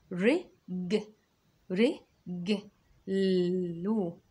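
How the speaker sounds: sounding out رج then لو slowly; syllable by syllable. Shown as a demonstration. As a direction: break the word into syllables. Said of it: "اسمع كده". (تقطيع الكلمة مقطع مقطع)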